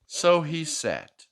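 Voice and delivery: lively picture-book read-aloud; reading to a child. Acted. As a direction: read it like a bedtime story for a little one.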